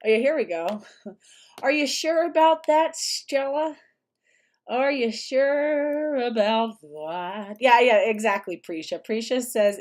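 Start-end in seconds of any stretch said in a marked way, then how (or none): 1.6-3.8 high, raised-voice taunting question; mock questioning. Mock challenge aimed at Stella. 4.7-7.6 very slow, stretched-out taunting question; drawn-out mock doubt.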